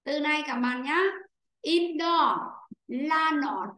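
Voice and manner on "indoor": "Indoor" with IN stressed, higher and louder. Shown as a demonstration. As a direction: hit the first syllable IN in "Indoor", let door fall away. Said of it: "Rơi vào chữ in".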